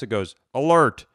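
usual speaking voice

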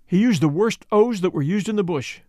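plain reading voice

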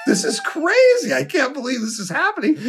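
very high, excited exclamation; retold disbelief. Son watching [name] come closer, high giddy disbelief. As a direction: act out giddy panic, pitch leaping up.

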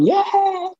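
a short, high burst of goal joy; retold yell. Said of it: "rather than just shouting".

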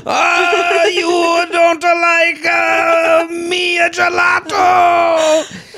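high, shouted Italian shopkeeper; slow, outraged, laughing through it; angry gelato shop owner. Retold or imitated. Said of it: "great impression of an angry gelato shop owner".

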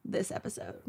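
whisper. Pretend. Ominous teaser.

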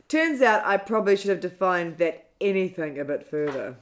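normal talking voice

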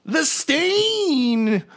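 very high squealing panic; panicked Krieg. Acted. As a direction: yelp it like a cornered little man. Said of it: "he turns around and is like".

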